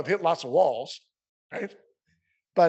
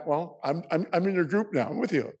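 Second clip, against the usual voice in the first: ordinary talk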